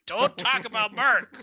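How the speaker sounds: yelled scolding, high and loud; angry shout. Retold or imitated. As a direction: bark it out at them. Kobold shouting at others.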